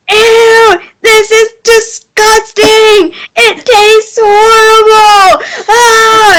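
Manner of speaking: high, slow mock wail of disgust; disgusted scream. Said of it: "screaming about how horrible it tastes".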